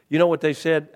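loud, high rhetorical question; loud emphasis. Acted. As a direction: call it out to the room. Preacher punches the question.

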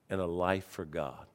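ordinary talk, nothing marked

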